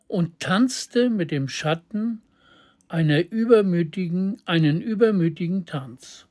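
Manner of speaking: ordinary delivery, nothing marked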